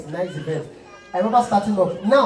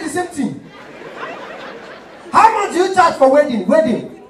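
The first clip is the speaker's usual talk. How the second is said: high, loud mock caller; 11 semitones higher, 7 dB louder than usual. Caller voice in phone story.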